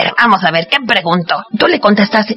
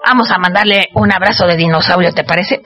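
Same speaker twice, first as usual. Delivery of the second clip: ordinary delivery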